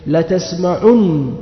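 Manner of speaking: "لتسمعن" said very slowly, the doubled نون held; teacher sounding out the final form "لتسمعن"; slow demonstration. Shown as a demonstration. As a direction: draw out "لتسمعن" to show the merged نون. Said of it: "فأصبح الفعل إيش؟".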